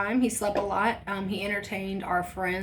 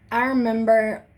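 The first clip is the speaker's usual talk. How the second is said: normal talking voice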